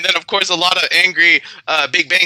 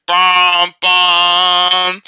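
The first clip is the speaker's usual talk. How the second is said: imitated ship horn; 4 dB louder than usual. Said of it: "with the horn and everything".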